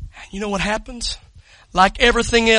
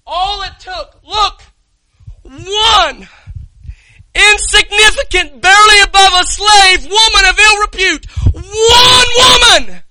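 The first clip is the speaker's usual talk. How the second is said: full-force preaching; 12 semitones higher, 11 dB louder than usual. Acted.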